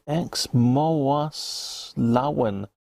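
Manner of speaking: very slow, syllable by syllable attempt at a Welsh name; struggling with Welsh. Shown as a demonstration. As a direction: sound out the Welsh words carefully, very slowly. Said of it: "That sounds Welsh".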